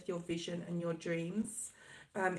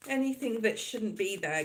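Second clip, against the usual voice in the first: usual speaking voice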